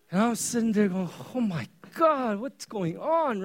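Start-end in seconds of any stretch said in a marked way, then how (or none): 1.3-3.4 mock alarm. Dad reacting to son's tears.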